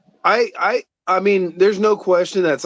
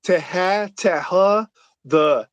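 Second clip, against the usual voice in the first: a faked stumbling stutter on each syllable; mock stutter; 3 semitones higher, much slower than usual. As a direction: pretend to stammer through the word. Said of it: "like, stuttered".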